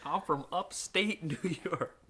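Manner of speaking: hamming up a goofy upstate New York twang; put-on upstate New York accent. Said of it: "Now I got a stupid voice".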